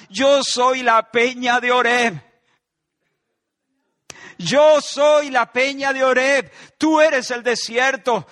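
the preacher booming Jesus' words; loud proclamation. Acted. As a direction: proclaim it slowly like a banner. Proclaimed line, then repeated hushed.

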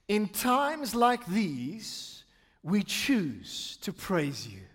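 very slow, spaced phrases with long pauses; solemn lyric recitation. Acted. Pastor reciting worship lyrics to God, slow and reverent. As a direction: recite it as worship addressed upward, drawn out.

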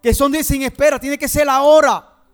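fiery preaching. Acted. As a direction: preach it out with rising force. Preaching continues at full force. (predicación encendida y fuerte)

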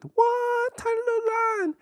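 squeaky-high falsetto; tiny voice. Acted. Podcaster minimizing a disputed lyric, tiny high voice. Pokes fun at objected-to line.

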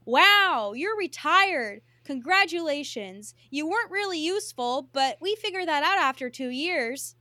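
loud, bright-toned mock praise for a face mask, slowed on retired; mock cheery pop star. [name] congratulating a used face mask, loud mock cheer. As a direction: gush at the mask like a cheery host.

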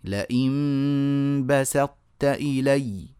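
بسطت recited slowly, the س thin and the ط full; drawn-out Quranic recitation of بسطت; careful recitation. Shown as a demonstration. Teacher demonstrating tajwid to students. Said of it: "شايفين السين".

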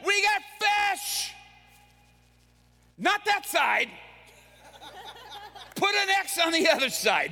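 high, shouted excitement; thrilled Peter. Acted. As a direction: yell it in disbelief and joy. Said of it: "And Peter's like".

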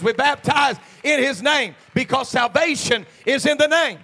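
loud pulpit drive, slowing on the last words; full-force preaching. Acted. Pastor preaching loudly to his church about baptism in the name. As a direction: hammer the point about the name home.

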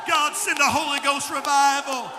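loud, rousing sermon climax; rally everyone to stand and shout; full-force preaching. Acted. Altar call preached at full force.

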